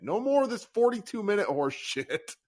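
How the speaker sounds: high, loud worked-up rant; excited rant. Real, not acted. Host ranting about Kemba minutes.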